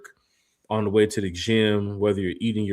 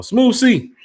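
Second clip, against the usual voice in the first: excited callout; 10 dB louder than usual. Real, not acted. Calling out a chat name.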